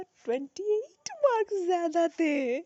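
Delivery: slow, high, drawn-out reveal of good news; thrilled reveal. Retold or imitated. Exam taker telling viewers her surprise marks, thrilled. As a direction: relive the thrill, stretching it out high.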